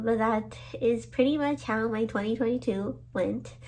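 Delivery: ordinary talk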